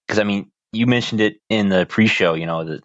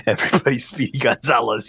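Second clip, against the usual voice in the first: cartoonish fake Mexican accent, higher; mock Speedy Gonzalez accent; 3 semitones higher than usual. Mocking old Hollywood accents, hammed-up Mexican accent. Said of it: "offensive racist accent".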